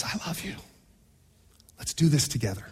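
very soft, low and intimate; tender whisper. Acted. God answering those who yell at him, whispering. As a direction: murmur it gently, close to the ear. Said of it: "He whispers".

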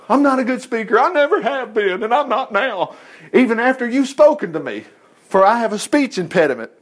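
high, put-on pleading voice; mock-helpless protest; pleading Moses. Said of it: "but Moses pleaded".

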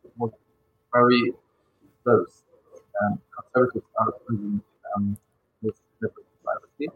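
choppy breaking-up call audio; glitchy robotic call. Real, not acted. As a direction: voice cutting in and out. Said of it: "the audio is breaking up again".

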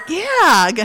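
high, loud mock-excited burst; all excited. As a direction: imitate an over-eager cheer. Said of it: "all excited".

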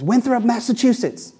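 high, ringing call of the hometown; exhausted player calling out his hometown; yelled hometown. Retold or imitated. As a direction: shout where you're from, spent but proud. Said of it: "he yells out where he's from".

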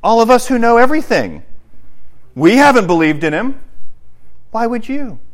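high-pitched mock alarm; mocking know-it-all. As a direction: parody a self-important scoffer. Mocks the Pharisees' argument.

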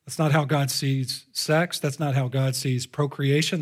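usual speaking voice

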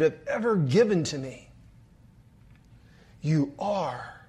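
put-on a father soothing his little girl; tender reassurance.

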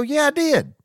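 very high, loud, triumphant insistence; emphatic high yell. Retold or imitated. Insisting to wife he really bought it, high yell.

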